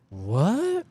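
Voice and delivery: shocked high what; surprised. Real, not acted. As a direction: blurt it out in disbelief. Reaction to tater tots.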